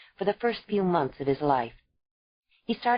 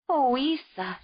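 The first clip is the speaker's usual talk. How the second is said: put-on young mother crying out, a bit higher; 8 semitones higher, slower than usual. A narrator's reading. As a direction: cry her name in pain. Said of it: "she cried".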